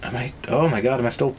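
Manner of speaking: usual speaking voice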